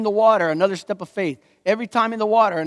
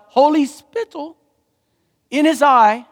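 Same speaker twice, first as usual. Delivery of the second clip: put-on English accent; 7 semitones higher, 6 dB louder, slower than usual. Repeats the accent joke, grandly.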